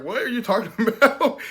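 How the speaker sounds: high, loud incredulous question breaking into a laugh; laughing disbelief. Retold or imitated. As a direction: ask it laughing, can't believe it. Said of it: "looked at him like".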